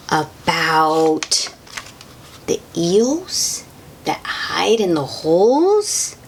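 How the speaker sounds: usual speaking voice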